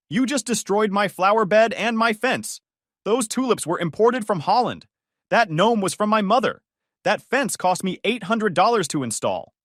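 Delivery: high, loud, listing every loss in outrage; enraged homeowner's rant; furious tirade. Acted. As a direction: rattle off the damages like a prosecutor. Joyce lists her losses.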